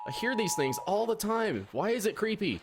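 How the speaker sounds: high, anxious; spooked and pleading; unnerved. Real, not acted. Unnerved by siren sounds.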